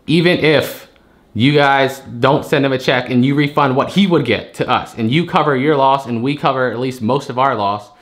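ordinary talk

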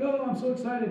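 high-pitched, gushing recruit, kept soft; mock excited. New recruit fresh from boot camp, imitated as excited. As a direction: imitate an overeager newcomer in a small high voice. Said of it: "you're all excited".

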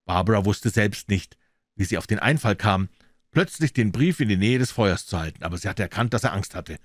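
usual speaking voice

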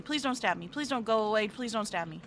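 ordinary talk, nothing marked

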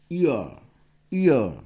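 You are run together as one word; contraction demo. Shown as a demonstration. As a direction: say You are as a single quick syllable. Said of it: "তোমরা এটা পড়বা". (সংক্ষেপে you are উচ্চারণ)

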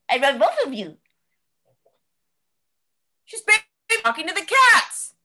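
usual speaking voice

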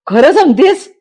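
high, loud joyful surprise; husband bursting with happiness at the news; overjoyed asking if it's true; delighted disbelief. Acted. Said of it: "तो आनंदाने फुलून येतो".

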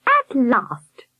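ringing cry. A narrator's reading. Miss Hilliard cutting in on Albert, mildly ringing call. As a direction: let it ring out, interrupting. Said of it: "voice rang through the room".

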